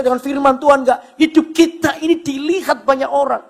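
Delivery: high, emphatic pulpit exhortation; full-force preaching. Real, not acted. Preacher urges the congregation that many watch their lives, forceful exhortation. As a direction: drive each phrase home to the congregation.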